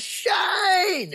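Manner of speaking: high mock shout to a long-lost mate; imitating excited greeting. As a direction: call out overjoyed, pitch shooting up. Rotten runs out greeting old friend.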